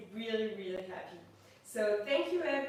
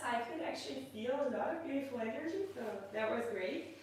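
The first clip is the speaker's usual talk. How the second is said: ordinary talk, nothing marked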